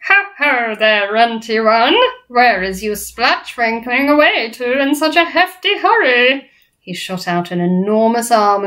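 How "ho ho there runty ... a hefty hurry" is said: big, loud, higher jeering voice; drawn-out giant taunt, rising at ends; booming bully giant. A narrator's reading. Flesh Lump Eater accosting BFG, booming bully. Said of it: "boomed the flesh lump eater".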